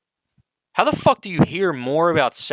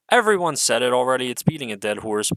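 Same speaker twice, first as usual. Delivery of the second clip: ordinary talk, nothing marked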